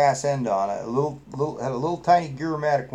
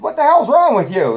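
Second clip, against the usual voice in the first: high, loud, indignant outburst; mock an outraged principal telling off a student; raised-voice exasperated scolding, acted out; imitated angry principal; 11 semitones higher, 9 dB louder than usual. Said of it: "he didn't take that too kindly".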